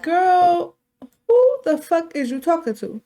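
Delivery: high, loud sassy clapback; sassy loud comeback. Acted. Soraya telling off her mother, loud and sassy. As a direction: snap back at her loudly. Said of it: "Soraya's like".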